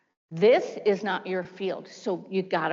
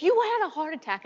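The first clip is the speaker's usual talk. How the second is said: husband insisting; 8 semitones higher than usual. Retold or imitated.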